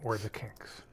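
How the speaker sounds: soft unvoiced whisper. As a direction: barely breathe the words. Echo of band quiz.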